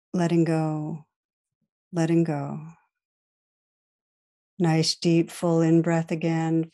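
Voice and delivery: calm guided meditation voice with long pauses. Real, not acted. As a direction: guide listeners gently inward, leaving space to breathe.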